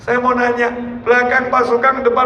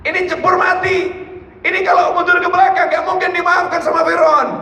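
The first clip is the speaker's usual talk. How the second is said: panicked shout; 8 semitones higher than usual. Acted. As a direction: shout blame at the leader in panic. Panicked followers shouting at Musa. (umat panik berteriak menyalahkan Musa)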